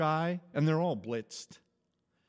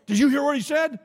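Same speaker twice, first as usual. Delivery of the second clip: higher and louder, mock amazed question; excited volunteer; 9 semitones higher, 10 dB louder, faster than usual. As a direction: lightly act the amazed volunteer.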